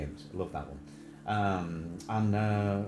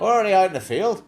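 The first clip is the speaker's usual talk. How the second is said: high, loud mock Northern Irish; Northern Irish accent; 10 semitones higher, 10 dB louder than usual. Mimicking the phone woman for laughs, in Northern Irish. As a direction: imitate the agent, pitched up in Northern Irish.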